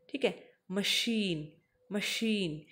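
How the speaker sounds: मशीन said məʃin, very slow and deliberate; मशीन with a clear sh sound; slow pronunciation demo. Shown as a demonstration. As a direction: draw out मशीन for the class. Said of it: "सबसे पहले आपको pronounce करना है".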